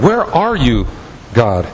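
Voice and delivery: usual speaking voice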